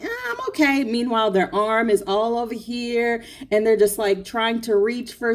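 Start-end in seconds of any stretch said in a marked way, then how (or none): 0.0-0.8 high squeezed "I'm okay"; cramped person voice. Retold or imitated. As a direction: squeak it out, pretending to be fine.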